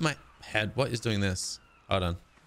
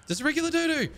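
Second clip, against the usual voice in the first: much higher sing-song voice for a cute animal; cutesy pet voice; 11 semitones higher than usual. Pretend. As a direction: coo at it like a pet. Greeting a dodo in game.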